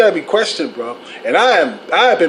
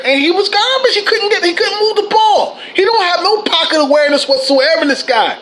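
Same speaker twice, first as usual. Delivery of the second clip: very high-pitched, incredulous complaint; exasperated rant; 9 semitones higher than usual. Real, not acted. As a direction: let the voice climb high in exasperation.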